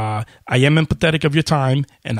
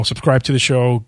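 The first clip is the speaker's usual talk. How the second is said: normal talking voice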